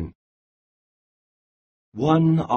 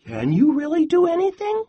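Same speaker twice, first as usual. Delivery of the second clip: much higher, fast little squirrel voice; squeaky squirrel; much faster than usual. Acted. Squirrel asks Bear again, squeaky and quick. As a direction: pipe it up quick and tiny.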